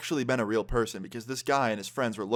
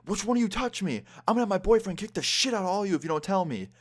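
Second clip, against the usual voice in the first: much higher-pitched mock threats; imitating angry drunk girl; 10 semitones higher than usual. Drunk girl threatens group with her boyfriend, imitated anger. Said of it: "more slurred and angry".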